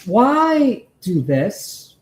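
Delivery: normal talking voice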